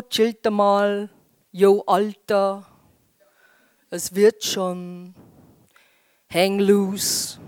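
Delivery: lightly voiced laid-back teen, slow; a hint of a chilled-out teen dude; teen slang. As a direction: drawl it like a cool teenager. Mocking teen slang talk.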